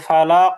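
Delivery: ফালাক said loudly with a bouncing final qaf; loud teacher's model of ফালাক with qalqalah. Shown as a demonstration. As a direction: punch out ফালাক, echoing the last consonant. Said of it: "কলকলাটা উচ্চারণ হয়নি যথাযথভাবে".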